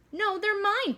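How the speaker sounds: high, insistent refusal; boy refusing to hand over his goggles; defiant protest. Acted. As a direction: cry out that they're his. Peter refuses the bullies.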